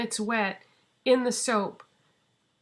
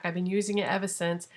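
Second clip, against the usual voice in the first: normal talking voice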